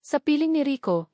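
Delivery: plain reading voice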